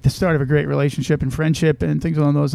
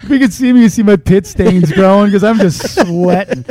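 laughing; 5 semitones higher, 7 dB louder than usual. Real, not acted. Laughing at own nervousness.

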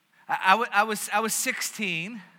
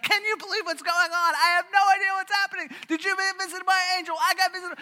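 high, fast, breathless wonder; two friends squealing over big news; high, rushed, excited girlish chatter; giddy excitement; 10 semitones higher than usual. Retold or imitated. Mary and Elizabeth embracing, amazed.

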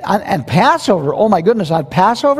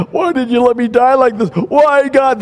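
mock anguished complaint; 7 semitones higher than usual. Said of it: "they're not gonna say".

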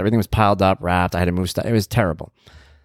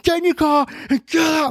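very high, frantic mock shriek; freaking-out scream. Mover pointing the couple to their car, high frantic scream. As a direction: order them off at screaming pitch. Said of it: "I'm freaking out".

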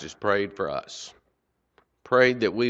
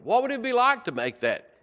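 excited lift; 14 semitones higher than usual. Real, not acted. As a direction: repeat the question pitched much higher. Preacher repeats question, much higher.

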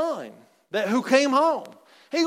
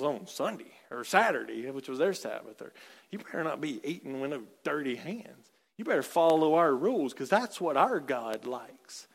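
low, quiet, fast sneering preacher-voice parody; mock the rule-keepers who scold the crowd; mocking the legalists; 8 semitones lower, 7 dB quieter than usual. Preacher parodies the Pharisees scolding sinners, low fast sneer. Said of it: "And these guys like".

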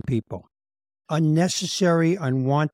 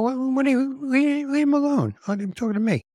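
high-pitched mock protest; hammed-up indignant plea; mock outrage; 9 semitones higher than usual. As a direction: defend the salesman in a raised, squeaky voice. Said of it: "I go".

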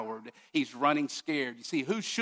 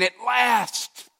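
higher, louder, slower proclamation; triumphant announcement; 4 semitones higher, 6 dB louder, slower than usual. Retold or imitated. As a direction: herald a long-awaited king. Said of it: "as if the author is saying".

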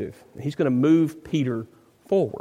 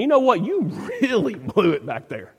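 hammed-up rebuke with a scoff; mock scolding. Preacher voicing what Jesus never told Peter, mock scolding. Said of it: "At no point do we see Jesus going".

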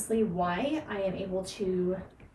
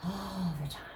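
hushed echo; 6 dB quieter than usual. Real, not acted. Coach echoing herself after co-host agrees, hushed emphasis. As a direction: drop "all the time" to a near whisper.

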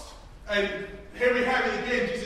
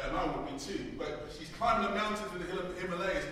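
ordinary delivery

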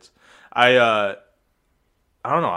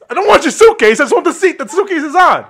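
loud, high-pitched mock exasperation; exasperated; 10 semitones higher, 6 dB louder than usual. Rustic guy arguing for the seat, mock exasperated. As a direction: argue hotly, half-laughing. Acted bus quarrel over seat.

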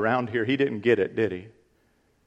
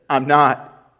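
angry denial; 7 dB louder than usual. Acted. Peter cursing denial.

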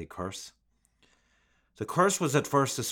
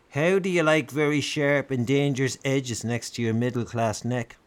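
skinhead snarl, higher and louder; menacing thug snarling threat; 5 semitones higher, 7 dB louder than usual. A narrator's reading. Skinhead Stuart threatening the doctor at knifepoint, snarling. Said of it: "snarled Stuart".

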